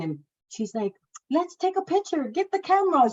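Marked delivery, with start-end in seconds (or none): 1.3-3.1 much higher, bubbly urging; eager midwife. Retold or imitated. Midwife urging a photo with the newborn, high and eager. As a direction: chirp it out like an overeager helper.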